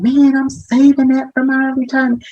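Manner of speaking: imitating a frustrated patient, pitched well up. Patient objecting to using retirement savings to pay for care, frustrated. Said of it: "that can be very frustrating".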